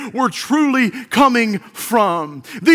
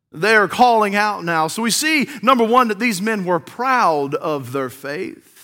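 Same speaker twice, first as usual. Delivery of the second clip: ordinary talk, nothing marked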